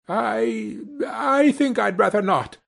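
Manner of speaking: a lightly voiced frightened miser; nervous Scrooge. A narrator's reading. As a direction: back away timidly.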